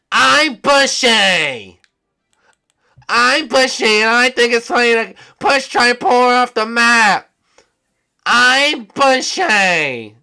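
put-on high, slow, loud old-librarian voice; Bushy impression. Taunting Bushy in front of the lobby. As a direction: drag each word out, squeaky and old. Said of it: "he sounds like an old librarian".

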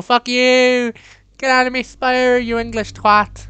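hammed-up Irish Saint Paddy telling the intruder off; shouted mock Irish. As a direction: bellow it like a pint-soaked Irish landlord.

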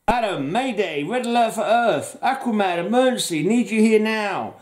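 high-pitched comic-book mayday; frantic distress call. Acted. Hero calling absent teammates for emergency help, mock frantic.

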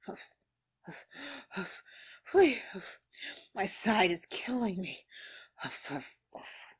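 soft mock huffing breaths; imitating heavy panting. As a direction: huff it out like you're winded. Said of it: "He is out of breath".

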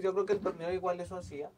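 normal talking voice

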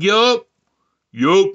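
loud one-word answer; imitated curt yup. Woman answers his question, loud emphatic imitation. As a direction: punch out "Yup" loudly. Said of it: "She said it like that".

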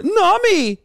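high, drawn-out swooning call; hammed-up infatuated shout; mock lovestruck cry. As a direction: imitate a simp going bananas. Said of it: "he goes fucking bananas over".

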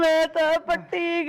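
tearful wail. Acted. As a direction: cry out as if ruined. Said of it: "ਰੋ ਨਾ ਮੇਰੀ ਧੀ ਰਾਣੀ". (ਰੋਂਦਿਆਂ ਵੈਣ ਪਾਉਣਾ)

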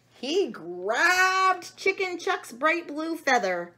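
high, slow, drawn-out narrating; dramatic narration. A narrator's reading. Reader tells of the monkey's grab, slow dramatic narration. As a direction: stretch it out for suspense.

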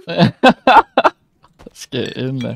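slow, laughing mimicry; laughing echo. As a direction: drag it out while laughing. Laughing repeat of teammate's line.

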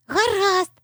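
high, happy little fox; delighted high-pitched okay. A narrator's reading. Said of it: "зраділа Аліса".

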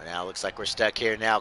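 usual speaking voice

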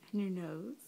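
normal talking voice

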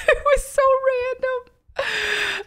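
high-pitched, squeaky with laughter; real laughing bafflement. Real, not acted. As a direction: talk through helpless giggles. Laughing over her absurd dream.

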